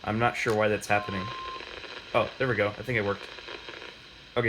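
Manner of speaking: ordinary talk, nothing marked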